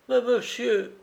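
very high, pleading protest; protesting servant. A narrator's reading. Servant protests at being fired.

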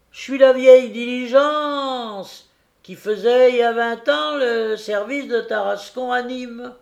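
put-on high, cracked old coach voice; old fairy voice. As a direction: speak as a creaky old stagecoach. Old diligence speaks as character.

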